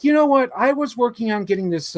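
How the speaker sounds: lightly voiced excited sister; sister's voice. Sister voiced in retold story.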